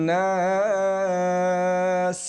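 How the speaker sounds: slow chanted মালিকিন নাস with a long nasal hold; recited with ghunna. Acted. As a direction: sing it out and hold the n.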